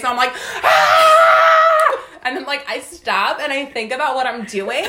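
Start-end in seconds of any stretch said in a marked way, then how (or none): 0.6-2.0 high, sustained imitated scream; loud mock scream. Mother screaming back at her screaming child, high mock scream. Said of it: "screaming in my face".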